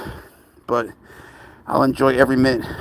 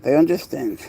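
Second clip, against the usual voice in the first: normal talking voice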